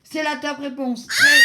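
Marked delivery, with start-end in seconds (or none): 1.0-1.4 shouting and laughing with joy; excited shout. Real, not acted. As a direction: yell it out, delighted and laughing. Top answer revealed, host erupts.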